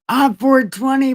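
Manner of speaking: excited. Real, not acted. Excited aside about the date.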